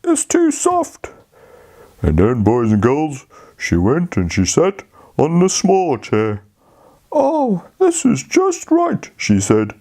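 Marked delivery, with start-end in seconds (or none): 0.0-1.1 put-on high, slow little-girl voice; a fussy little girl, pitched up high; Goldilocks. Goldilocks voice in story. 7.1-9.1 put-on high, slow little-girl voice; a fussy little girl, pitched up high; Goldilocks. Goldilocks voice in story.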